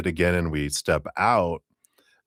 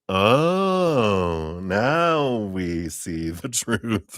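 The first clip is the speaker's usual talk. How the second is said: laughing knowingly; 3 semitones higher, much slower than usual. Real, not acted. Knowing reaction to a caught-out ex.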